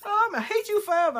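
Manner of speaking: high, loud, fast mock of a dramatic hater; hammed-up high squeal of someone swearing eternal hate; mocking quote. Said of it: "I know someone say".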